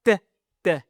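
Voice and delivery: the sound "T" as a short crisp tap; letter sound demo. Shown as a demonstration. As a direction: model the sound "T" on its own. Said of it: "fait le son".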